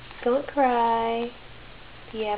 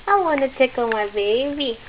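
ordinary talk, nothing marked

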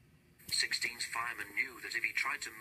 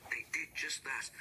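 ordinary delivery

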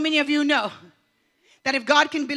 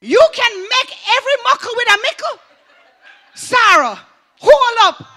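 excited realization; 6 semitones higher, 7 dB louder than usual. Real, not acted. Excited realization of her heritage.

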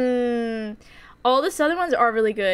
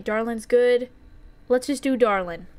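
usual speaking voice

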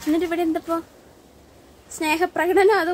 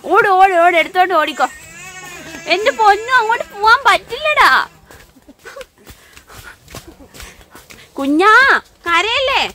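loud urging; 5 semitones higher, 9 dB louder than usual. Real, not acted. Mother loudly urging and hushing her crying daughter. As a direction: shout encouragement over the wailing.

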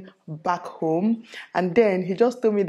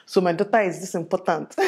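laughing through a proud-dad line; laughing mock-proud dad. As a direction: giggle as you quote him.

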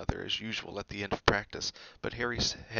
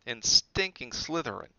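scornful; 4 semitones higher, 5 dB louder than usual. Acted. Harry's scornful joke.

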